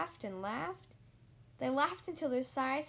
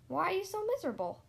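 eager question; 6 semitones higher, 4 dB louder, faster than usual. Acted. Mister Happy asks eagerly.

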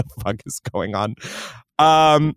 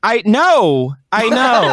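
loud defensive; 7 semitones higher, 5 dB louder, much slower than usual. Real, not acted. Loud mock-defensive reply.

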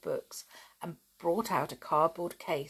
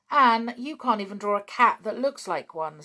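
louder, higher, mocking a sister; scornful; 4 semitones higher, 8 dB louder than usual. A narrator's reading. Dick mocking Anne's drawing, scornful. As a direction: scoff at her drawing. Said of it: "said Dick scornfully".

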